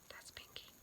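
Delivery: breathy whispered aside; hushed aside to the listener. Acted. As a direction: lean in and whisper it like a secret.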